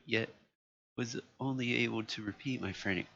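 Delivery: ordinary delivery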